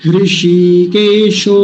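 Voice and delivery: हृषिकेशो very slowly with the ee held long; don't rush, stretch the shee in हृषिकेशो; long drawn-out vowel. Shown as a demonstration. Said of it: "लंबा खींचना है इसको". (ई को लंबा खींचकर)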